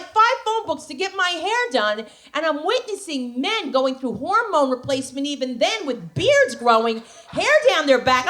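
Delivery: normal talking voice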